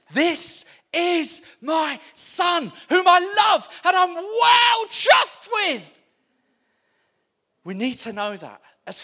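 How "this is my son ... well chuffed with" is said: much higher and louder, slow proclamation; booming voice of God. Acted. Preacher voicing God the Father over Jesus, shouted. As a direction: call it out like God from heaven.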